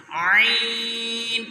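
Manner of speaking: put-on teacher shows the child عین from the throat; throaty ain. Shown as a demonstration. Teacher draws عین slowly from the throat. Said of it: "یہ گلے سے نکالنا ہے".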